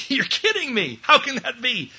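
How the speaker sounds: high-pitched mock disbelief; incredulous. Arnold Palmer doubting the blind golfer, incredulous. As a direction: jump up high in amazed protest.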